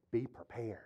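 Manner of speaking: low, hushed teacher's warning; quietly imitated teacher's catchphrase; hushed imitation. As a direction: drop it low and soft, mock-ominous.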